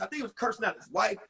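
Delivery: ordinary talk, nothing marked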